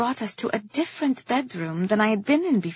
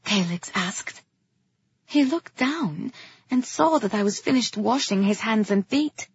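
plain reading voice

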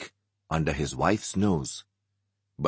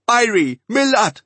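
put-on loud, high call to the children; 8 dB louder than usual. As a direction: shout their names across the house. Clara calling the kids.

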